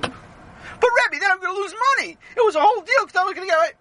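very high, squealed mock crying; whiny wail. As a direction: wail in protest like a distraught child. Sammy wails about losing money.

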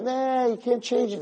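high, drawn-out "nah"; mock dismissal of the idea; dismissive naysayer. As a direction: wave it away in a high, slow voice.

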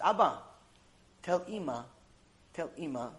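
soft, halting last request; subdued farewell. Acted. Son's last message for his mother, subdued. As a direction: speak softly, with pauses between phrases. Isaac's last words to father.

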